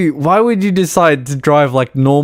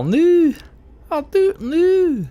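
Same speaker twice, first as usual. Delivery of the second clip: 1 squeaky mock no; slower than usual.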